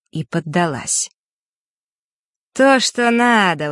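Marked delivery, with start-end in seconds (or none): 2.5-3.7 slow, higher satisfied approval. A narrator's reading. Mark approving his experiment's success, satisfied. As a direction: draw it out, pleased with the result. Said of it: "удовлетворённо произнёс Марк".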